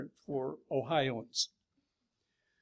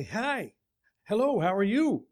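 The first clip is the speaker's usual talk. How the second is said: friendly grown-up answer, a little raised; adult's reply; 5 semitones higher than usual. Retold or imitated. Preacher replying to kids who greeted him in English, friendly answer. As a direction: answer the kids kindly in your own voice. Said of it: "you say".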